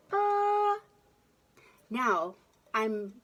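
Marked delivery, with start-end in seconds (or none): 0.1-0.8 high sung ah into an echo bucket; echo microphone. Shown as a demonstration. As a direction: call out ah into the echoing container. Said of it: "Watch this".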